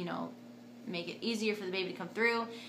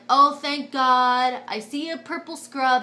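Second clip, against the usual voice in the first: louder, higher burst of relief, first words drawn out slow; relieved cry; 4 semitones higher, 10 dB louder, slower than usual. Retold or imitated. Seeing the anesthesiologist walk in, a slow loud relieved cry. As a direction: gasp it out like rescue just arrived.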